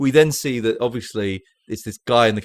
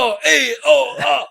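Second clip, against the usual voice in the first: high, loud hammed-up groans; mock groans of pain; 7 dB louder, slower than usual. Retelling the beating scene, high loud mock groans. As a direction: groan like a guy getting hit over and over. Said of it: "this guy's like".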